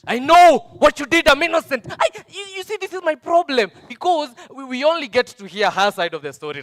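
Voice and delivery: high, loud indignant rant; shouting his innocence. Acted. Abuser insists loudly he is innocent.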